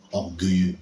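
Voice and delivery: a hint of a stern divine rebuke; authoritative voice of God. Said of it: "une voix autoritaire".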